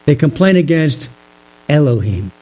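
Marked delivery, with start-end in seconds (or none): 1.7-2.3 Elohim said low and detached, at arm's length; Elohim, deep and impersonal; distant, cold name. Shown as a demonstration. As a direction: say Elohim as if keeping God far off. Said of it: "Can you tell the difference there?".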